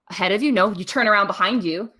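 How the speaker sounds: normal talking voice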